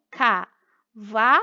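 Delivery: "Cavalo" slowly split as ca, va, lo; syllable by syllable. Shown as a demonstration. Teacher recapping the syllables found, "Cavalo" slowly broken apart. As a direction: leave a gap after each syllable of "Cavalo". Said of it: "você achou as sílabas".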